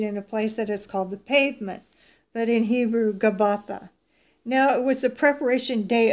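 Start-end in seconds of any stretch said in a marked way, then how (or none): none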